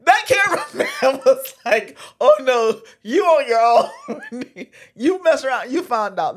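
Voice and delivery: loud, laughing through the story. Real, not acted. Retelling the cameraman bailing out, laughing hard. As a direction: crack up while telling it.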